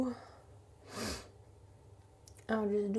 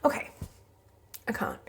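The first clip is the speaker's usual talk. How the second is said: normal talking voice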